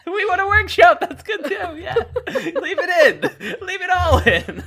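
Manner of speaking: high, loud squeal through laughter; shrieking with amusement; real hysterical laughing. Real, not acted. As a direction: barely get the words out laughing. Hysterical at the running joke.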